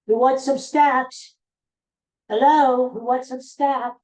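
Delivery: high, loud sing-out to the dogs; calling dogs. Real, not acted. Host tempts his dogs through the door, calling out.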